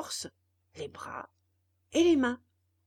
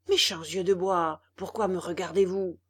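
louder, annoyed scolding of the wooden eyes; irritated; 3 semitones lower, 6 dB louder than usual. A narrator's reading. Geppetto scolding the puppet's eyes, irritated. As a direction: snap at the staring eyes. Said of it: "dit d'un ton irrité".

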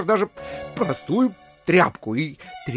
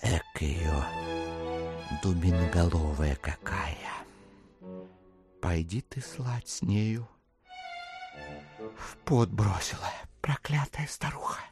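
muttered cursing; 10 semitones lower, 9 dB quieter than usual. Acted. Exasperated buyer cursing the old woman aside, low mutter. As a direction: curse under the breath, hushed by the end.

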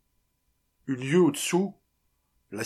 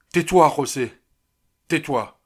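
agitated outburst, louder and higher; crying out; 4 semitones higher, 9 dB louder than usual. A narrator's reading. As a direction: cry it out, losing self-control a little. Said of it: "s'écria Martinez, qui ne semblait plus être maître de lui". (exclamation emportée, plus forte)

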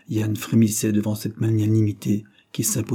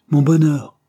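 loud, higher bitter scoff; sinister sneer; 5 semitones higher, 8 dB louder than usual. A narrator's reading. Jealous brother scoffing at his twin's wishes, sinister sneer. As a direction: throw the words back mockingly. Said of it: "Yann eut un ricanement sinistre".

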